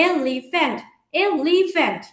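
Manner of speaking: Elephant as এ, লি, ফ্যান্ট, each part distinct; three-part pronunciation. Shown as a demonstration. Teacher showing elephant in three parts, elephant split by syllable. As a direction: say elephant twice in three separate beats. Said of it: "তিন ভাগে উচ্চারণ করতে পারি".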